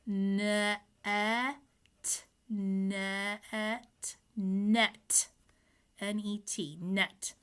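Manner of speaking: "net" sounded out slowly; sounding out. Shown as a demonstration. Teacher sounding out the word for kids. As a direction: say "net", spell N E T, blend "net" again. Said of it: "let's sound it out".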